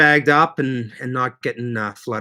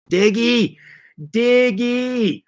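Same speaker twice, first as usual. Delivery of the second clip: calling out; 10 semitones higher, much slower than usual. Real, not acted. Greeting a viewer by calling out.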